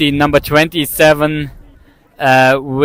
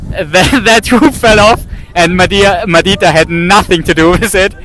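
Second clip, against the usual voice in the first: higher, amused and laughing; 4 semitones higher than usual. Real, not acted. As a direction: giggle at the absurd moment. Laughing at fallen hoop.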